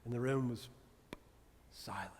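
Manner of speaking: dropped to a whisper on silent; hushed telling. A narrator's reading. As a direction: let the word silent fade to a whisper. Dramatic hush of the silent room.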